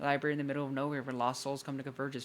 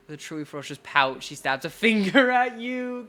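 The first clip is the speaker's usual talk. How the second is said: loud squeal of amusement through the narration; laughing while reading; 8 semitones higher, 12 dB louder than usual. Real, not acted. Reader tickled by her ferocious pout, laughing squeal. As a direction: read it louder and higher, breaking into a laugh.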